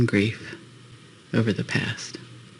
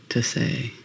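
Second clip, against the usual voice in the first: usual speaking voice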